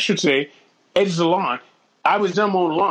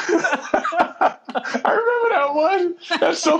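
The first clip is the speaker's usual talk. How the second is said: high, laughing reply; real laughter; 11 semitones higher, much slower than usual. Real, not acted. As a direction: laugh as you remember it. Laughing at the prank.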